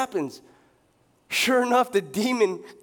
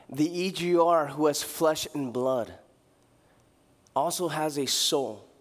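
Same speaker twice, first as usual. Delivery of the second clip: ordinary delivery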